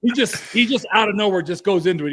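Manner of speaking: ordinary delivery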